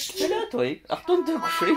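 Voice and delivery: high voice sending the pet back to bed; pet talk. Real, not acted. Sending her pet back to bed, high pet voice. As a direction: shoo the animal to bed, pitched high.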